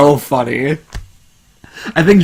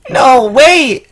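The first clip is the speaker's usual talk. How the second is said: shouting; 10 semitones higher, 4 dB louder than usual. Real, not acted. Reacting to fanfic reading.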